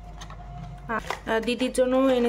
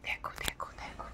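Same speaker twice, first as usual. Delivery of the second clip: whisper; 17 dB quieter than usual. Real, not acted. Mother whispering to her baby. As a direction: hush it, just a whisper.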